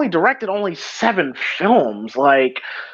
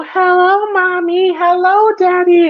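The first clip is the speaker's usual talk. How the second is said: put-on very high, drawn-out child's call; high singsong child voice; imitated little girl; 12 semitones higher, 4 dB louder, slower than usual. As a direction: mimic a small girl greeting her parents. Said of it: "just hearing her go".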